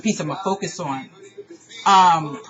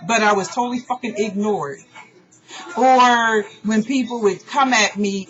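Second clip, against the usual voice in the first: ordinary talk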